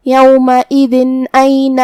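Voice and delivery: یومئذ أين recited with a clear n sound joining the words, no hum; clear noon without ghunna. Shown as a demonstration. Teacher demonstrates izhar, یومئذ أين with clear unhummed n. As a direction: sound the tanween of یومئذ as a crisp n straight into أين. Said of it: "بغیر غنہ سے یہ حکم کو ادا کریں گے".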